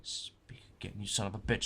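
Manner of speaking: soft, unvoiced threat; menacing whisper. Real, not acted. Storyteller daring the heckler to speak again, in a soft menacing whisper. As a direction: dare them in a whisper.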